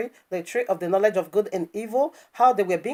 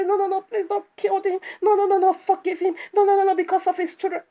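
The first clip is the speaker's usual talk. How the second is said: high, fast, whiny mock pleading for mercy; hammed-up tearful begging, mocking her; mock crying plea; 10 semitones higher than usual. Preacher mocks a woman begging Italian authorities, in a high whiny voice. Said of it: "go and cry and say".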